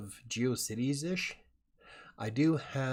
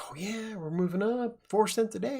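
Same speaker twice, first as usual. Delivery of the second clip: excited; 11 semitones higher than usual. Retold or imitated. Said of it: "I'm like".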